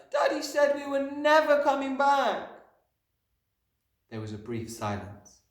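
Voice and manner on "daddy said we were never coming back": put-on very high, loud child's voice; upset little girl at high pitch; anguished cry. A narrator's reading. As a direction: wail the news to your teacher. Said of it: "Matilda cried".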